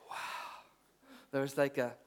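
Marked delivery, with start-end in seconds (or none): 0.1-0.6 breath-only, hushed wow; whispered awe. Retold or imitated. Preacher recalling his moment in the shed, whispered. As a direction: whisper it as a private realisation. Imagined reaction while writing, whispered.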